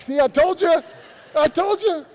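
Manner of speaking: loud, high crowing I-told-you-so; playful high-pitched yell; excited mock alarm. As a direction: shout it high, as if proved right. Mock alarm after a sudden scare.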